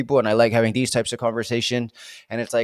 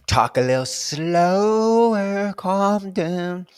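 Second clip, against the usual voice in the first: self-calming slow talk; slower and calmer; 7 semitones higher, much slower than usual. Real, not acted. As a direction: ease off the pace deliberately. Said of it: "Maybe I need to".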